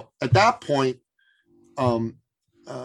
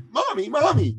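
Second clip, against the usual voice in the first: put-on excited little boy; 12 semitones higher, 4 dB louder than usual. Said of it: "like little boys do, all excited".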